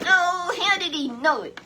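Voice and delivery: high, loud outcry of wonder; excited outcry. Real, not acted. Preacher marvels at Elijah's foresight, high excited cry.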